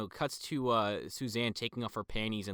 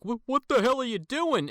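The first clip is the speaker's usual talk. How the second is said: higher, louder alarmed shout; startled shout; 12 semitones higher, 9 dB louder than usual. Retold or imitated. Man finds a woman at the fireplace, startled shout. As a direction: yell it out in sudden alarm.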